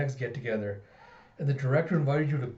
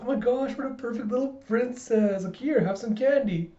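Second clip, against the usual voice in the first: high-pitched sugary cooing; gushing adoration; 9 semitones higher than usual. Acted. Colleagues offer the child candy, gushing. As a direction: gush over a little child, high and sweet.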